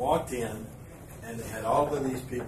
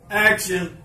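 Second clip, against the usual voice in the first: louder, higher call; called action; 6 semitones higher, 10 dB louder than usual. Retold or imitated. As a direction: shout to start the take. Director calling action.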